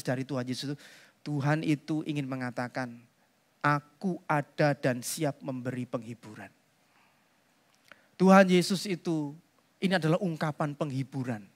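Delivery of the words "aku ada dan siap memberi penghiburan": much softer and lower, gentle promise; tender reassurance. Acted. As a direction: speak as a comforting presence. Jesus' meaning voiced.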